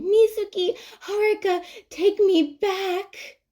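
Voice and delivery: high, slow, hammed-up begging; mock whiny plea. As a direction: whine like a lonely kid begging to come home. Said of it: "come back to our apartment going".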